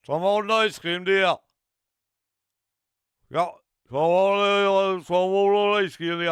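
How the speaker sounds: put-on a doting old voice handing over leftovers; the universe talking. The universe itself addressing him, slow and high. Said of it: "the universe was like".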